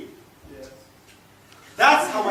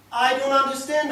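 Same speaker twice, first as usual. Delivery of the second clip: indignant protest; 5 semitones higher, 6 dB louder than usual. Acted. Argues with companion about punishment.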